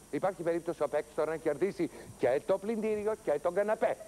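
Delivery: ordinary delivery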